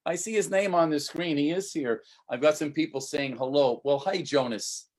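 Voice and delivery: ordinary talk, nothing marked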